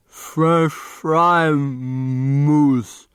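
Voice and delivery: put-on very slow, effortful boy's voice, high; halting boy. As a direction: drag each word out with effort. [name] jokes with slurred speech.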